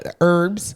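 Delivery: "herbs" said without the H, as "erbs"; "herbs" starting straight on the vowel; silent H. Real, not acted. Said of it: "I say herbs".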